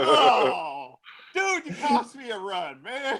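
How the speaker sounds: high, laughing whine of protest; mock complaint. Player to the one hit, high mock complaint. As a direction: groan it out high, half laughing. Acted reaction to being hit.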